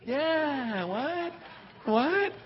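high, soft, clueless voice; Scott Crawford. Retold or imitated. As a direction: imitate a baffled husband playing along. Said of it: "we're just like Scott Crawford".

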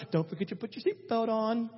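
lightly voiced nagging mom; mom moment. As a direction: imitate a mom's parting reminder. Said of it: "mom just has that moment".

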